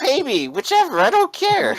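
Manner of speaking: high-pitched, exasperated character voice; panicking shop owner. Acted. Store owner only wants his money, high-pitched panic. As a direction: push for it in a very high voice. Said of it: "he's kinda panicking".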